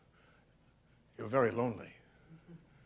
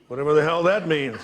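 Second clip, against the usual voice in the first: louder, with a rueful laugh; 4 dB louder than usual. Acted. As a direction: laugh off the jargon. Laughing off the diagnosis.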